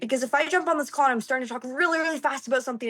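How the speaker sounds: fast. Shown as a demonstration. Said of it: "it shows that I'm passionate".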